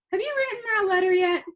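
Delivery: high, loud scolding grandma voice; exasperated grandma. A narrator's reading. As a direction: chide him like a fed-up grandmother.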